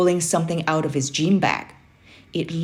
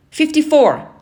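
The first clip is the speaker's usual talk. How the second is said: much higher, loud yell; 11 semitones higher, 7 dB louder than usual. A narrator's reading. Boy finally remembering the answer, yelled. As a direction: blurt it out in a burst. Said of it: "I yelled".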